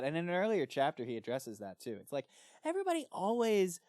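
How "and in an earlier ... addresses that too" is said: ordinary delivery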